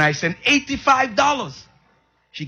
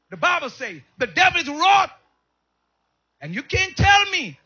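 full-force preaching; 10 semitones higher than usual. Real, not acted.

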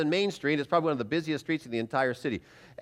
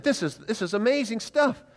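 amazed; 7 semitones higher than usual. Retold or imitated. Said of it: "I said".

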